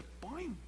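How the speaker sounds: sonido de resorte agudo y suelto; boing de resorte. Retold or imitated. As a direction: imita un resorte que salta. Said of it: "se paró como resorte". (onomatopeya de resorte, aguda y breve)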